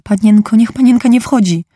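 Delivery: put-on a maid calling out to her young mistress in alarm. A narrator's reading. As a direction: call out to stop the girl. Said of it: "Bridget wołała".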